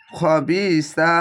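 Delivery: খবিসা drawn out very slowly, heavy খ and long final vowel; খবিসা recited slowly with a heavy খ; Quranic recitation. Shown as a demonstration. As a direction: stretch খবিসা slowly, thick খ. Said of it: "মোটা কর খ যাবার খ হবে".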